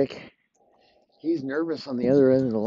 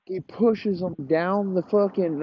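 normal talking voice

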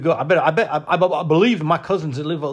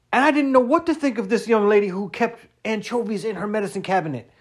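ordinary talk

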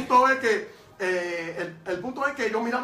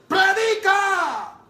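one very slow word, yelled much higher and louder; hoarse shouted command; 11 semitones higher, 8 dB louder, much slower than usual. Retold or imitated. Evangelist shouting at the young man to preach, hoarse bellow. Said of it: "la segunda voz y dice".

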